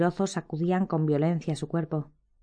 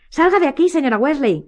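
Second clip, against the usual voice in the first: high, urgent shout; loud cry, pitched far up; 9 semitones higher, 7 dB louder than usual. A narrator's reading. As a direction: yell at her to get out. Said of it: "gritó Harry".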